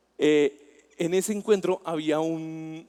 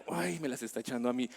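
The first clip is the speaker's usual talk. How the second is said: offended mutter; 5 semitones lower, 10 dB quieter than usual. Retold or imitated. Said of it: "cuando la leyera dijera".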